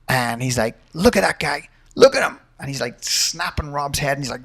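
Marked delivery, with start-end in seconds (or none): 0.9-2.4 higher-pitched imitation of an angry coach; mock-yell a sharp order at a fighter; retold mock yell. Coach dad ordering his fighter in the corner, mock yell. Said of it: "he's just screaming at Rob".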